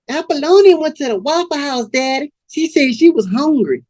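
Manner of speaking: put-on high-pitched girl's voice; girl reporting to Daddy. Girl explaining Apollonia's absence to Daddy, high-pitched. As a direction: answer up high as one of the pimp's girls.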